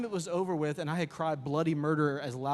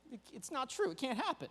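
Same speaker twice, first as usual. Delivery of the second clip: disbelief; 8 semitones higher, 6 dB quieter than usual. Acted. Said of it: "he was like".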